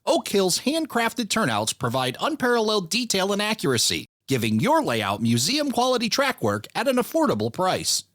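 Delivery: upbeat sponsor read. Acted. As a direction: read it like a radio ad. Host reads sponsor ads.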